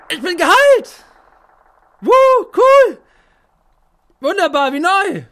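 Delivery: overjoyed. Acted. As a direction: cheer like he's brand new. Cured and overjoyed.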